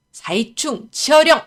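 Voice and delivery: slow, loud spell-casting cry; shouted incantation. A narrator's reading. Scholar summoning a rat into the air, shouted incantation. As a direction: call out a spell, drawing it out. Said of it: "하고 외치며".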